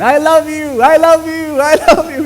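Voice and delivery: put-on high, loud little boy yelling; happy child calling out at a valley; child's shout. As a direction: shout joyfully like a small boy.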